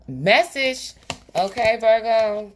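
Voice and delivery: high, loud, drawn-out exclamation; punchy, high-pitched proclamation; emphatic call-out. Real, not acted. Calling out a sign-off.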